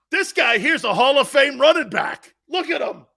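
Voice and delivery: high, loud excited boasting; imitated grandfather raving. Grandfather learns Franco is Italian, excited raving. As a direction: rave like a newly converted fan.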